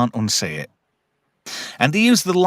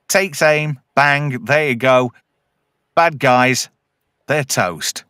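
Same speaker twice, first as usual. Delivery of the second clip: ordinary talk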